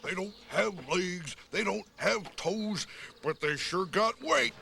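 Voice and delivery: ordinary talk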